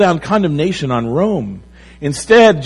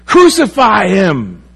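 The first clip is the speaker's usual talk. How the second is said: loud, higher, drawn-out demand; imitated crowd shout; 6 semitones higher, 6 dB louder, slower than usual. Preacher voicing the crowd against Jesus, loud slow shout. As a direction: call it out slowly like an angry mob. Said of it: "they're shouting out".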